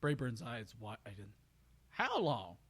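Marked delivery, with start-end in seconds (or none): 1.9-2.6 high, slow, drawling country voice; ramble, puzzled and friendly; folksy country stallion. Acted. Brayburn introducing himself to the mare, high country drawl.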